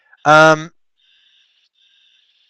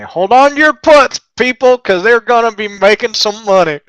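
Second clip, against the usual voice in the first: funny hollered catchphrase; 6 semitones higher than usual. Pretend. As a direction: holler it playfully, breaking into a laugh. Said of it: "That just sounds funny".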